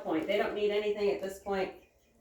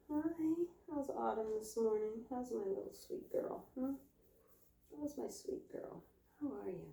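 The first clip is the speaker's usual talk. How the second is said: very soft cooing; tender pet talk; 2 semitones higher, 10 dB quieter than usual. Real, not acted. Woman checking on her sweet girl, tender and soft. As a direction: murmur sweetly to a beloved animal.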